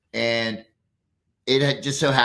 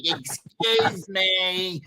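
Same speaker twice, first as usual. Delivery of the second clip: put-on higher, very slow old lady; 7 semitones higher, much slower than usual. The old lady at the bus stop, slow and higher. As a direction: draw it out like a timid old lady. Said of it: "She's like".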